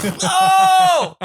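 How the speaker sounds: loud, high mock "oh!"; high sustained mock wail; comic yelp. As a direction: cry out as if someone just got hurt, hammed up. Comic cry at imagined injury.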